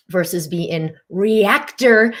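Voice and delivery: ordinary talk, nothing marked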